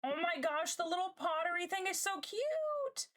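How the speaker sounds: high-pitched excited gush. Real, not acted. Streamer fawning over cute little pottery, gushing squeal. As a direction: squeal with delight at something adorable.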